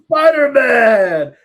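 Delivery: drawn-out ringside introduction, full volume; Bruce Campbell announcing. Retold or imitated. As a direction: boom it out to the arena like a ring announcer. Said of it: "And Bruce Campbell goes".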